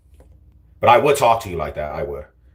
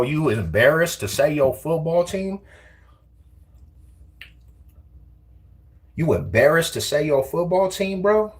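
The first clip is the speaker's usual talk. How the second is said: mocking taunt; 7 semitones higher than usual. Real, not acted. Taunting a chatter over team.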